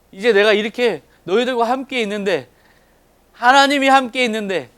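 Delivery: forceful preached first-person declaration; impassioned declaration. Acted. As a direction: build to loud, high proclamation. Jesus' speech builds in force.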